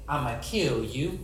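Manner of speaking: low, slow menacing threat with a laugh; a villain queen gloating over her threat, slow and laughing. Acted. Preacher voicing Jezebel threatening Elijah, slow laughing menace.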